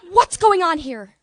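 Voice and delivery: loud, high, slow shouted demand; furious teacher. Retold or imitated. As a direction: burst in and shout it.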